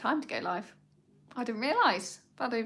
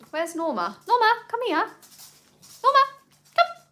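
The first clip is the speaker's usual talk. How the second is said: high, louder sing-song call to the dog; 13 semitones higher, 7 dB louder than usual. Real, not acted. Owner calling her dog Norma over, high coaxing call.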